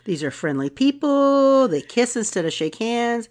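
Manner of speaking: usual speaking voice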